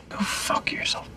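hissed under breath; whispered swearing. Acted. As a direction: whisper it with contempt. Whispered curse.

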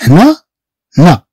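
normal talking voice

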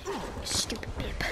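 unvoiced hissed whisper; whispered insult. Real, not acted. Gamer whispering insults at the enemy, unvoiced. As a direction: hiss it under your breath.

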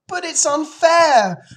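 very high, drawn-out loud protest; crying out. Acted. Fern objects to her father, loud high cry. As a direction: wail it as a wronged child. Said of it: "cried Fern".

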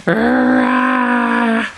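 drawn-out mock growling roar; bear roar. Parent playing bear for a child, long roar. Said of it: "What does a bear do?".

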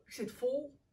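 puffed out with a little laugh; a light full-bellied puff. A narrator's reading. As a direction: blow it out, stuffed full. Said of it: "Schildpad puft".

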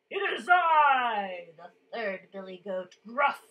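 big voice, loud opening burst; big loud goat. A narrator's reading. Third billy goat announcing himself to the troll, booming. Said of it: "his voice was as big and loud".

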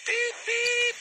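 very high, far-off whistle toot; Edward's cheerful high whistle; whistle beep. Acted. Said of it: "he whistled".

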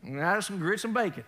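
lightly hammed-up Southern greeting; Southern accent. Preacher imitating folks from the South, Southern accent. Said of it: "they're like the Southern".

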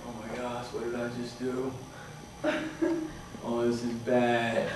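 low, hushed, sinking self-talk; soft, low-pitched dread after a blunder; quiet dismay. Retold or imitated. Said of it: "I miss it".

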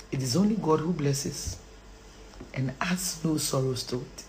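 soft, low recitation; subdued and very soft; hushed verse. Real, not acted. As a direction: recite it quietly, almost under the breath. Hushed first quote of verse.

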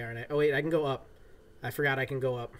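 ordinary delivery

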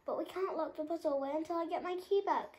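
fast, high worry; lion frets about his key; fretful lion. A narrator's reading. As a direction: rush out the worry. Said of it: "said Mortis".

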